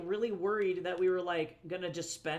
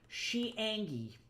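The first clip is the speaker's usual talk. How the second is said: put-on higher, slow cutesy baby talk; 4 semitones higher, slower than usual. Shown as a demonstration. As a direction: say it like a pouty baby. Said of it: "She angy".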